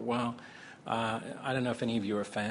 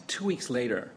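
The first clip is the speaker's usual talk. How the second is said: ordinary talk, nothing marked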